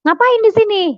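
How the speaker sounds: high startled question; surprised husband. Retold or imitated. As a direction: ask it as a caught-off-guard husband. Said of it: "Suami saya kaget". (suami kaget bertanya dengan nada tinggi)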